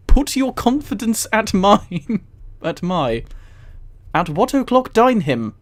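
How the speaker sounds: ordinary delivery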